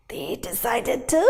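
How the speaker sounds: usual speaking voice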